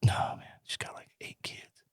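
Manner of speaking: unvoiced hush, trailing away; whispered. Real, not acted. Answering the host about his sister in a whisper. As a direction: drop to a bare whisper.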